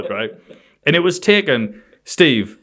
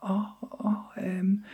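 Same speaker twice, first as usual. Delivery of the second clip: slow, hushed, higher uneasy reply; awkward whisper; 9 semitones higher, 9 dB quieter, slower than usual. Retold or imitated. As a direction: flounder softly for words. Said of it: "And I went".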